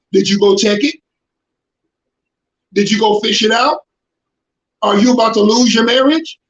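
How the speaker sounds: ordinary talk, nothing marked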